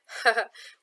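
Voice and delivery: short, loud burst of laughter; amused laugh. Real, not acted. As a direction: laugh briefly at the question. Amused by the question she reads.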